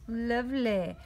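ordinary talk, nothing marked

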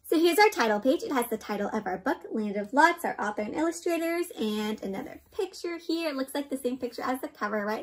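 ordinary delivery, nothing marked

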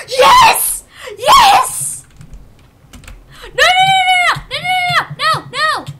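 very high, loud yells of joy turning to no; ecstatic then despairing shouts. Real, not acted. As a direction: scream yes, then scream no as it breaks free. Catch almost succeeds then fails.

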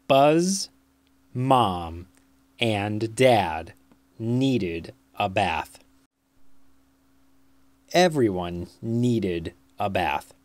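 plain reading voice

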